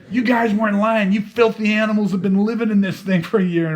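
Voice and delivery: high, loud, revolted rant; imitating a disgusted border guard yelling. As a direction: berate them while recoiling.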